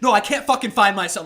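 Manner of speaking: loud retold frantic insistence; freaking out. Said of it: "Like, freaking out".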